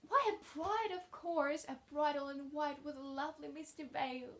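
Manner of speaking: put-on rapturous child, high and lilting; delighted exclamation. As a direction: exclaim it as if it's obvious and wonderful. Child excitedly answers own question.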